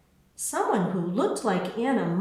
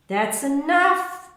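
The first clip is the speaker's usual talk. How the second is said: higher, louder, slow and firm; insisting; 7 semitones higher, 5 dB louder, slower than usual. A narrator's reading. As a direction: put your foot down, slowly. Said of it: "Mama insisted".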